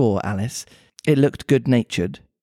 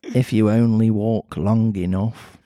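put-on Mancunian accent; 3 semitones lower than usual. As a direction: lower Mancunian cat voice. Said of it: "something a little bit pervy about this accent".

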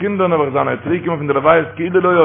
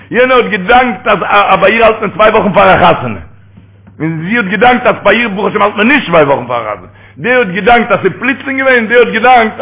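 fervent preaching; 5 semitones higher, 4 dB louder than usual. Acted. Preaches with raised voice.

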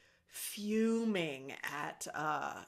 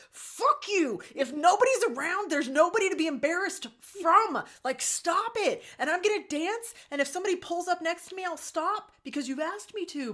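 higher, louder defiant rant; mock tirade; 10 semitones higher, 5 dB louder than usual. Mom defies daughter about dancing in the car, mock loud rant. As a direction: tell her off with mock defiance. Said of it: "I looked at her and I'm like".

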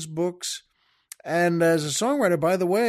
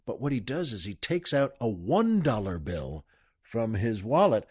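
ordinary delivery

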